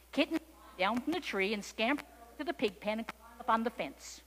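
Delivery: plain reading voice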